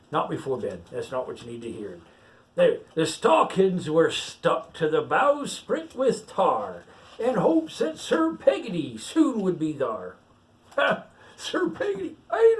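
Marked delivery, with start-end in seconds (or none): 2.6-10.2 pirate reading a picture book aloud; story reading. Acted. Pirate reads the rhyme to the child, storytelling lilt. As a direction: read the verse out like a bedtime tale.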